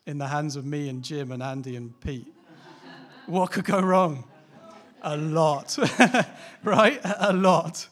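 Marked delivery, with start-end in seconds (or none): 3.3-4.3 loud, slow mock question; a loud, drawn-out sarcastic question; ironic. Real, not acted. Ironic about risky money. 5.0-7.9 loud laughing answer, pitched up; loud, high laughter breaking through. Real, not acted. Laughs at own joke.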